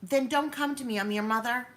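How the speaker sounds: mother scolding her son loudly; retold angry retort. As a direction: fire it back at him, stung. Mother snapping back at son.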